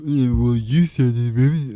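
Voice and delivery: loud, inarticulate mock man voice; mocking caveman guy voice. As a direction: garble it like women imitating guys. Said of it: "I don't get why women go".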